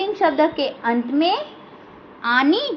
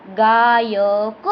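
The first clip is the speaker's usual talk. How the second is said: slow dictation; 4 semitones lower, much slower than usual. Shown as a demonstration. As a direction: stretch out गायक very slowly. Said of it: "अक". (बहुत धीरे खींचकर बोलना)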